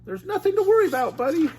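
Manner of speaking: high quick Shaggy to his buddy; Shaggy reassuring. Acted. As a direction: brush off the worry quickly. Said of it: "Shaggy told Scooby".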